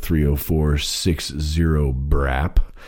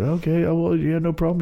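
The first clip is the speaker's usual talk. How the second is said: high mock polite reply; 11 semitones higher than usual. Retold own reply.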